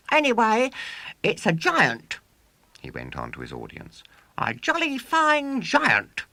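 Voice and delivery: put-on high, loud boastful showman boy. A narrator's reading. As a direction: crow it like a swaggering boy showman. William boasting to audience.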